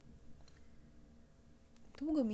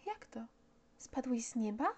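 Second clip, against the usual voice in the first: put-on child exclaiming; 2 semitones higher than usual. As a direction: cry out in amazement like a small boy. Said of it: "Zawołał".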